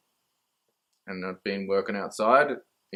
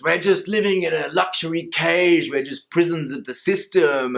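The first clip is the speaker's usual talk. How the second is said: higher, louder mock inner grumble; inner voice griping; 5 semitones higher, 5 dB louder than usual. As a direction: play the whiny inner voice complaining about the cage. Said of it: "the mind goes".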